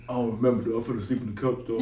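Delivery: low, soft, offhand voice; groggy mumbled reply. Retold or imitated. Seller admitting he slept on the couch, low and groggy. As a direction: play the seller, low and hazy.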